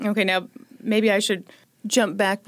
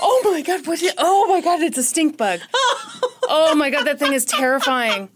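startled shriek; 6 semitones higher than usual. Real, not acted. Stink bug scare.

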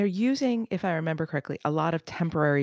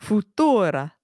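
hammed-up Italian accent, higher and louder; 5 semitones higher, 4 dB louder, slower than usual. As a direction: say it like a proud Italian. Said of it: "I'm gonna do this ridiculous Italian accent for it".

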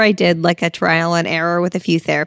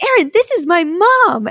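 high, bubbly introduction; much higher eager intro; excited introduction; 10 semitones higher than usual. Retold or imitated. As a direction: proudly show off her mom. Introducing therapist to mom.

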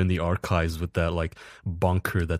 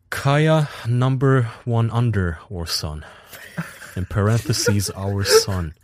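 slow, higher, grandly announced titles; announcing puns; 5 semitones higher, 7 dB louder, slower than usual. Acted. Host reading a listener's terrible Kaya puns as grand announced titles. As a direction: proclaim each pun like a royal title.

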